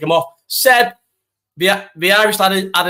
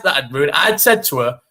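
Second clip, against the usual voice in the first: usual speaking voice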